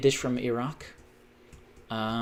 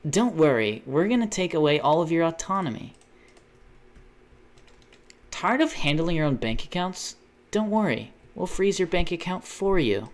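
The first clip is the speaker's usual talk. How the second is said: higher, punchy mock ad read; parody commercial pitch; 4 semitones higher than usual. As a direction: promise it like a sinister salesman. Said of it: "how many commercials are actually like that now".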